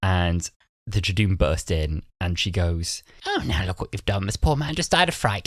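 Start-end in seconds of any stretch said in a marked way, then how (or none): 3.3-5.5 up-and-down mock-innocent lilt; imitated lilting cadence. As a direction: quote her with a swooping cadence. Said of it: "she has such a good cadence".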